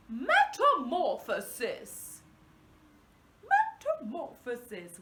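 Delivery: a touch of a clever scientist; intelligent scientist. Shown as a demonstration. Teacher saying the word like a scientist. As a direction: say it like a thoughtful expert. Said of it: "as if we are an intelligent scientist".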